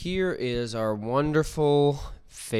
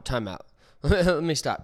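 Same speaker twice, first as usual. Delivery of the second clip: ordinary talk, nothing marked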